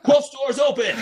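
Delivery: high, loud announcement broken by laughter; laughing triumph. Retold or imitated. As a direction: crow the news while laughing. Announcing success on Facebook Live.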